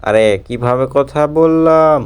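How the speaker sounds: slowly drawn out, playing dumb; slow mock puzzlement. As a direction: stretch every word like a confused cop. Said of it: "এভাবে কথা বলছেন কেন". (ধীরে টেনে টেনে বোকা সাজা)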